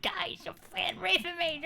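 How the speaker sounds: very high, thin mock shriek; annoyed screaming, imitated. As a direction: mimic her screaming fit in a squeaky pitch. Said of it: "like, annoyed".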